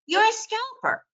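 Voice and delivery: high, bright mock surprise; quoting a stranger in a high surprised voice; mock surprised remark. Stranger telling trader she's a scalper, high mock surprise. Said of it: "Somebody said to me".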